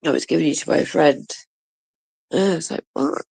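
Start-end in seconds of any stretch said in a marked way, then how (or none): none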